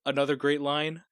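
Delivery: ordinary talk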